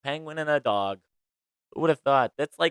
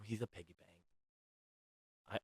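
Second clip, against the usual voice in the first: much softer and slow, a guilty secret; hushed confession; 4 semitones lower, 19 dB quieter, much slower than usual. Real, not acted. Confessing to the viewers that her dog is a piggy bank, much softer and slow. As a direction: let it slip out slowly and barely voiced.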